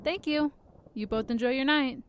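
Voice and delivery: friendly, high-pitched welcome-desk voice; warm smile. Acted. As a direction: send guests off with a smile. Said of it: "She says with a warm smile".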